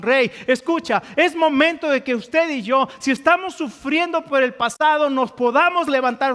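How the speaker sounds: insistent preacher urging the congregation on; impassioned preaching. Real, not acted. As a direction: preach it as a rousing call to rise up. Preaches a call to rise.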